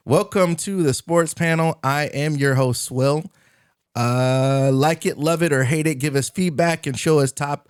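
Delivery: slow, announcer-style show intro; podcast host intro. Acted. Host opening the show for viewers, announcer read. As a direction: read it like a teleprompted opener.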